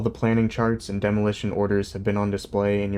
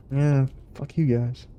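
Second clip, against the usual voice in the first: slower, higher, sneering mock voice; mock alien bully; 5 semitones higher, slower than usual. Streamer voicing the aliens at Earth, mock sneer. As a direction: toss it off like a smug invader. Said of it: "coming in and just like".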